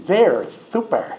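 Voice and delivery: "super" lisped sweetly as "thuper"; a little voice sweetly saying "super" with th for s. Shown as a demonstration. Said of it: "Do it sweetly and with a lisp".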